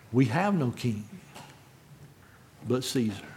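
low, soft answer with a heavy pause; quiet, grave quote. Retold or imitated. Preacher quotes the chief priests' answer, quietly grave. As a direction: say it softly and let the last words drop.

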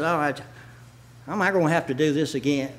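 low, soft, quick grumble; mock a reluctant churchgoer under your breath; grudging compliance. Preacher voicing a reluctant Bible reader, grudging.

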